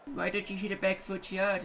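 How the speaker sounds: lightly voiced heckler from the comments; mock angry commenter. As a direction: imitate a nitpicking commenter. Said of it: "Don't yell at me in the comments".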